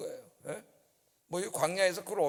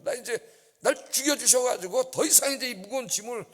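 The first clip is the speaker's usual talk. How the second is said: higher pleading voice; Moses' plea; 6 semitones higher, 3 dB louder than usual. Acted. Preacher voicing Moses' plea to God, higher. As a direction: beg to be relieved of the burden. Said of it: "이렇게 기도했습니다".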